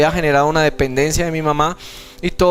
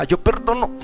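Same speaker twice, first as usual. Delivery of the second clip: higher, held-back re-enacted effort; struggling to say it; 5 semitones higher than usual. Son unable to forgive his father, struggling. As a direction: force the words out as if held back. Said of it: "no era capaz".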